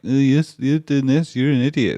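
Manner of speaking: high, loud sneering mimic; mock the critics' scornful tone; mocking quote. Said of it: "who've turned around and been like".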